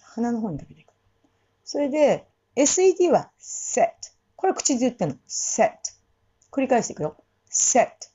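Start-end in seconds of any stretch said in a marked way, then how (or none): 3.4-8.1 セット from the mouth; no nasal セット; oral set. Shown as a demonstration.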